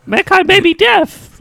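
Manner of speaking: high, slow mock commentator; Michael Cole quote. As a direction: lay it on like a commentator.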